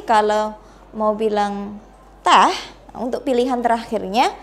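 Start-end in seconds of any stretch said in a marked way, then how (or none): 2.3-2.5 "teh" with a dropping tune; falling intonation. Shown as a demonstration. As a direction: let the pitch fall on "teh" as the last option. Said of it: "berarti using drop intonation".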